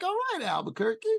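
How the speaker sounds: impressed by the nice shops nearby; excited. Retold or imitated. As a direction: cheer the city like you're impressed. Approving the neighborhood.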